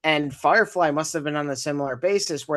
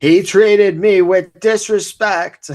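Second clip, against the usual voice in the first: slow, much higher and much louder, in a foreign-sounding put-on voice; Gal Gadot impression; 6 semitones higher, 12 dB louder, much slower than usual. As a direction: draw each word out, high and loud, like a bad celebrity impression. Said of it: "do an impression of Gal Gadot".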